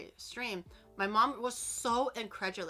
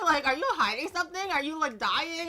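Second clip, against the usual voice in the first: higher, louder concerned questions; worried parents; 5 semitones higher, 6 dB louder than usual. Retold or imitated. Parents alarmed by their daughter's cheerful voice. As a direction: fire off anxious questions. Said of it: "They're gonna be like".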